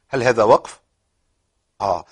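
usual speaking voice

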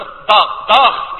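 داغ with an emphatic ض-like d, repeated loudly; showing the German sound as ض. Shown as a demonstration. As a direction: say داغ twice with a heavy d. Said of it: "في ألمانيا الضاد عاوتاني كاينه".

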